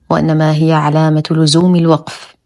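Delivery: normal talking voice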